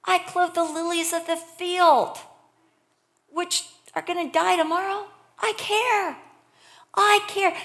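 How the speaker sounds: high, loud, incredulous voice of God; God insisting, high and emphatic; indignant God. Acted. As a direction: protest loudly, pitched well up, as an affronted God. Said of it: "God's like".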